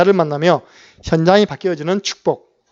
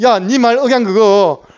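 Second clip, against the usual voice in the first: higher, louder, slow accusing call-out; accusing servant; 5 semitones higher, 6 dB louder, slower than usual. Retold or imitated. Servant catching Peter by his accent, loud and accusing. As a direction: point him out by his accent. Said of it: "억양이 딱 들리는 거예요".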